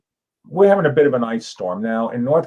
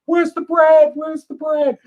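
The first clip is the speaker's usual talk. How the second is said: much higher, frantic woman's cry, louder at first; panicked shopper; 4 dB louder than usual. Pretend. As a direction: yell like a desperate woman in a looted store. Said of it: "we had one of the girls who worked there".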